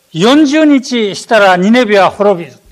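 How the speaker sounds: loud proclamation: 「四十日したらニネベは滅びる」 called out hard from 四十日; shouted proclamation. Retold or imitated. Jonah calling to the people of Nineveh, loud proclamation. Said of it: "アッシリア語で".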